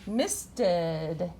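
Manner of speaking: "misted" with a stretched "ed" ending; exaggerated word endings. Shown as a demonstration. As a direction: draw out the ed in "misted". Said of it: "makes the ed sound".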